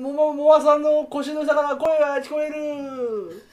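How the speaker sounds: high, loud, slow laughing amazement; mock astonishment. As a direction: act amazed through laughter.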